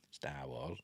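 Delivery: soft breathy whisper of "Star Wars"; whispered aside. Acted. Aside to viewers after reading the trailer title, whispered. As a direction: hush it like a secret.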